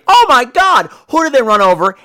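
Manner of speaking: high, loud mock gasp of shock. Reviewer retelling the crash, loud mock shock. Retelling car hitting someone.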